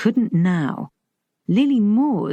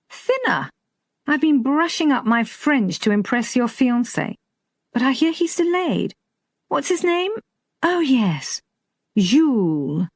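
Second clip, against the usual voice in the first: higher-pitched, casual banter; airy, raised-pitch small talk; lightly voiced teasing horseman; 7 semitones higher than usual. A narrator's reading. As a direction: lightly play an offhand old acquaintance.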